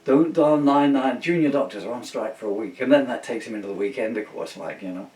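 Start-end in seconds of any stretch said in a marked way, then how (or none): 0.1-2.8 mock announcement. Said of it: "it says on the news".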